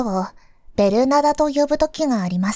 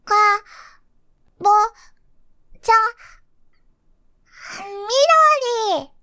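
put-on small child's voice; 9 semitones higher, much faster than usual. Child sounding out word.

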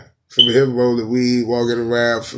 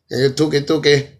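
higher mock dancehall word-doubling; 4 semitones higher, 4 dB louder, slower than usual. Comic mocks a singer's doubled words, mimicking dancehall delivery. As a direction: lightly mimic a dancehall star's repeated hook. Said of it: "saying the same thing twice".

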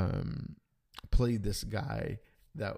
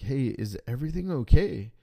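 loud, rising worried check; retold worried question, voice pushed up; anxious question; 4 semitones higher, 8 dB louder than usual. Anxiously asking friend at border.

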